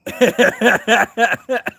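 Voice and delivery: high loud laughter around a name; laughing hard. Real, not acted. Streamer laughing at Lauren's message in chat, loud.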